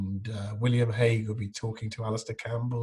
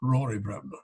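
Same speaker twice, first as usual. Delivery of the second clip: a hint of Connery's Scottish introduction; Sean Connery as Bremner; 3 semitones lower than usual. Connery's catchphrase twisted onto his own name, light Scottish. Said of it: "sort of going around saying".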